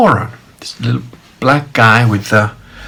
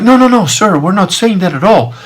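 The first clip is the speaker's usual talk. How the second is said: higher-pitched, conciliatory retold voice; hasty placating denial; 9 semitones higher than usual. Lady friend calms upset man, placating. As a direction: imitate someone soothing an upset customer.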